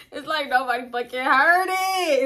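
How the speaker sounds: can barely get it out for laughing. Real, not acted. Laughing retelling.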